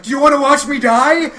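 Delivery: loud, high, hammed-up cry; mock desperate yell. Comic shouting at bar crowd, hammed-up loud yell. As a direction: shout it like a dramatic threat. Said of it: "I was like".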